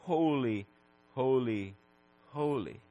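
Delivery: soft, low, spaced-out repetition; hushed reverence. Acted. Preacher dwelling on the holiness of God, hushed reverence. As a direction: let each word sink quietly. Reverent repeat of the seraph's cry.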